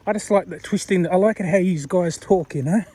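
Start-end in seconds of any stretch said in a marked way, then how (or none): none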